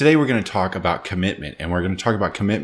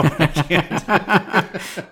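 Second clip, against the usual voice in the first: drawn out, higher, breaking into laughter; laughing admission; 10 semitones higher, much slower than usual. Real, not acted. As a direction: laugh helplessly through the words. Admits failed accent, breaks up laughing.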